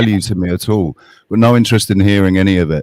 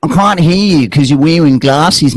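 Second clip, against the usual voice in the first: slow, higher put-on mocking voice; slow sneering mock; 5 semitones higher, slower than usual. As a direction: drag it out in a taunting silly voice. Mocking opponent with silly voice.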